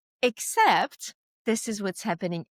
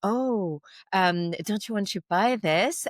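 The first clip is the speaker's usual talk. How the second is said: usual speaking voice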